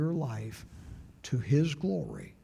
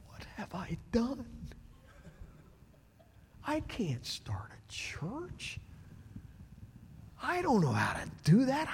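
high, hushed panic with a whispered middle; a frightened man lying awake, whispering to himself; distressed whisper; 11 semitones higher, 5 dB quieter than usual. Retold or imitated. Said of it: "I'm getting distressed".

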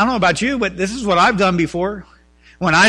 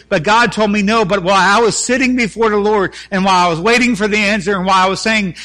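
higher, louder preaching; 4 semitones higher, 5 dB louder than usual. Acted. Pastor preaching David's story to the congregation, full force. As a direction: urge the church onward.

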